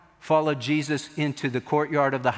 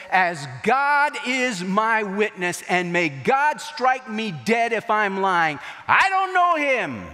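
desperate oath; 4 semitones higher, slower than usual. Retold or imitated. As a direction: swear it as if your life depends on it. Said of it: "This is what that means".

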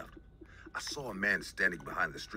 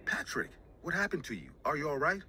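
high, startled concern; surprised; 8 semitones higher than usual. A narrator's reading. Narrator voicing Jenny's shock at Patrick. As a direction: ask it with sudden alarm. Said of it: "I said in a surprising voice".

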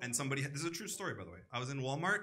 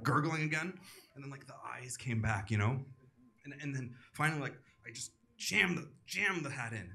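ordinary talk

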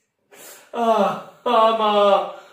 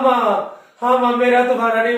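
normal talking voice

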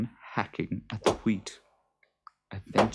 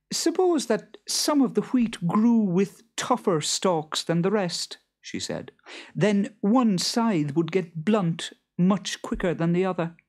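high, quick sly farmer's wife; Beth's voice; 12 semitones higher, 6 dB louder than usual. Acted. Beth slyly asking the Boggart, high and brisk. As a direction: tease him with the question.

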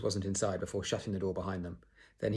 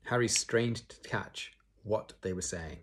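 usual speaking voice

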